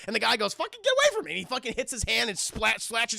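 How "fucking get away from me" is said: high angry yell. Acted. Detective shoves doctor away.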